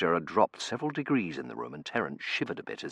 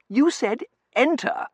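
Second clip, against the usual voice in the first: put-on young man voice; 9 dB louder than usual. Terence character voice.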